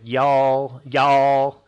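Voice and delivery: drawn-out Southern "y'all", loud on the repeat; Southern US "y'all", long open vowel; Southern accent. Shown as a demonstration. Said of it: "if you take a southern accent".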